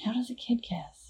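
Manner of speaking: hushed whisper of a question. Real, not acted. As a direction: ask it under your breath. Teasing hushed question to co-host.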